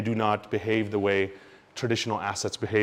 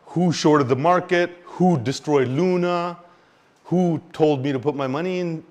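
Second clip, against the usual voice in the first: loud, slow, outraged demands; angry investor; 5 semitones higher, 5 dB louder, slower than usual. Retold or imitated. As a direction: shout the blame at whoever did it.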